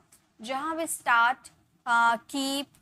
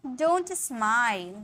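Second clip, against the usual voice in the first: slow teaching model; much slower than usual. Shown as a demonstration. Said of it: "हम बोलेंगे".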